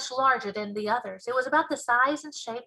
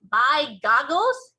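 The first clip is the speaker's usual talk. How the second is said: slow, louder, drawn-out; BFG exclamation; 4 semitones higher, 9 dB louder, much slower than usual. Acted. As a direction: savour the giant's odd oath.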